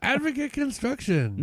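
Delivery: slow, high sponsor read; ad announcer. Acted.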